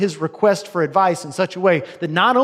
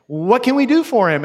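higher and louder, urgent question; excited royal demand; eager king; 7 semitones higher, 6 dB louder than usual. Acted. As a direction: press the scribe for an answer.

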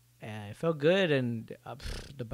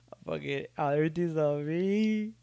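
fake crying; 4 semitones higher, 3 dB louder than usual. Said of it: "Just kidding".

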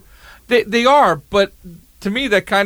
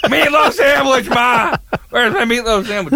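yelling across the room; 8 semitones higher than usual. Retold or imitated. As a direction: bellow for Ma like a spoiled grown man. Imitating Will Ferrell yelling for mom.

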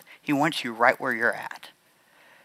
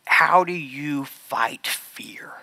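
slow, whispered, drawing the room in; hushed question; much slower than usual. Real, not acted. Asking the crowd his last big question, a slow hush. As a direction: lean in and breathe the question.

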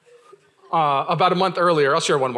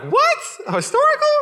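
very high, loud comic squawk; hammed-up high-pitched offence; shocked disbelief; 5 dB louder than usual. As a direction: imitate outraged disbelief. Husband's shocked reply retold.

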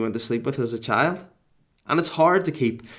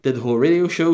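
usual speaking voice

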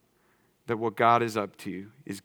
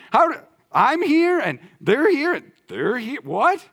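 much higher and louder, stunned and incredulous; amazed disbelief; 12 semitones higher, 6 dB louder than usual. Pretend. Believer in heaven marveling at who got in, amazed. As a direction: gasp it out like you can't believe who made it. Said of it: "We're gonna be like".

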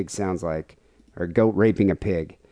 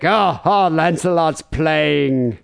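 rabbi voice; 5 semitones higher, much slower than usual. Retold or imitated. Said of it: "the rabbi would be like".

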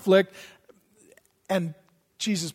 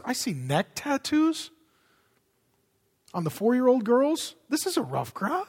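snooty Pharisee gossip; 8 dB quieter than usual. Retold or imitated. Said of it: "they're standing down the street saying".

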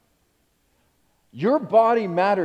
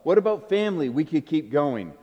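normal talking voice

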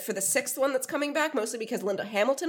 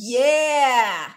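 excited shout; 7 dB louder than usual. Real, not acted. Excited shout about Terminator.

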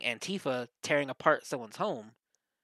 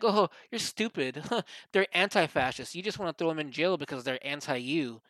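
raised, derisive put-on voice; mocking critics; 3 semitones higher, 4 dB louder than usual. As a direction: parody the outraged critics, half laughing. Mocking what everyone says, with laugh.

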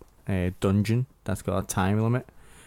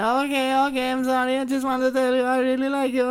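put-on high squeaky young boy voice, eager and loud; squeaky kid gamer; 3 dB louder than usual. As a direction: play an overexcited twelve-year-old fan. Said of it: "it's fucking screeching through my ears".

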